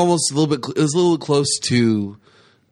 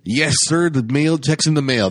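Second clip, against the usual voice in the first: lightly voiced movie exchange; quoting the film; 3 semitones higher than usual. Retelling a Big Trouble scene, light imitation. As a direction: toss off both lines quick. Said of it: "the one part was like".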